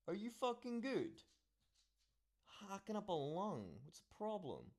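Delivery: soft, very high cooing voice; high-pitched aside. Real, not acted. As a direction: check on someone in a high, soft singsong. Aside to someone coughing nearby.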